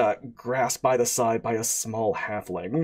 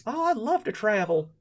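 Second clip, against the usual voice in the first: eager prince; 7 semitones higher than usual. Acted. Player voicing goblin prince.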